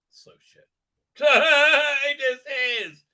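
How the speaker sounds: high, loud laugh into the words. Real, not acted. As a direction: burst out laughing while speaking. Laughs at how bad it is.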